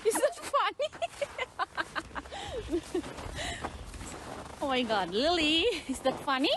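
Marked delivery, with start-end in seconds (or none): 0.9-4.6 high laughter. Real, not acted. Laughing at Lily.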